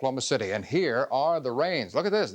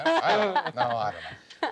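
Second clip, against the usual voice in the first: laughing; 3 semitones lower than usual. Real, not acted. Laughing at the Japanese question.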